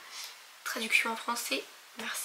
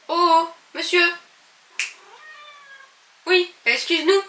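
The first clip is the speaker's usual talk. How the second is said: high, louder pet talk; mock apology to pet; 7 semitones higher, 6 dB louder than usual. Real, not acted. Youtubeuse s'excuse auprès de son animal, voix aiguë. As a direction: apologize to the animal in a raised cutesy voice.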